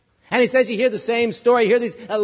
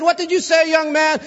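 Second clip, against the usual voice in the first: judge's question, pitched much higher; stern judge; 9 semitones higher than usual. Retold or imitated. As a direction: play the startled judge, higher. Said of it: "He said".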